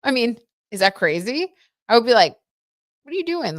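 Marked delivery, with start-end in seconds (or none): 3.0-3.6 high-pitched, put-on disbelief; mock incredulous challenge. Streamer imagining confronting her partner, mock incredulous. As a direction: question a partner in mock indignation.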